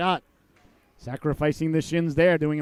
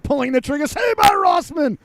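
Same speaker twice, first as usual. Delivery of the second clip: announcer calling a big save; excited save call; 9 semitones higher, 5 dB louder than usual. Real, not acted. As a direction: stretch out the save call loud. Shot and save.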